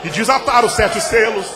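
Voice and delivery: loud, high, shouting preacher; fiery pulpit preaching; preaching at full force. Acted. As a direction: drive it home like a revival preacher. Preaching climax.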